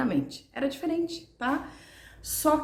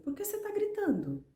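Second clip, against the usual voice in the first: mock calm parent; 10 semitones higher, 5 dB quieter than usual. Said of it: "eles olham assim pra você e falam assim".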